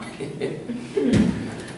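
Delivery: quiet airy laugh. Real, not acted. Teacher laughs at his own joke.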